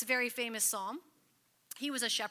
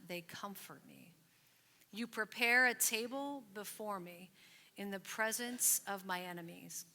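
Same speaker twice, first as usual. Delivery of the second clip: scripture reading; 2 semitones lower, slower than usual. Acted. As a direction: let each phrase settle, unhurried and fading. Leads congregation reading Psalm 23.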